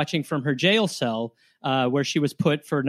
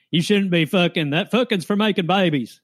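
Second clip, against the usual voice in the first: mock dragnet cop; 3 dB louder than usual. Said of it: "the dragnet cop heads are like".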